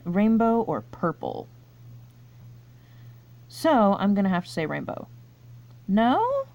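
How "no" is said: high, incredulous "No?"; can't believe you got it wrong; surprised disbelief. Real, not acted. Quiz player learning the answer was wrong, high disbelief.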